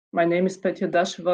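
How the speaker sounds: ordinary talk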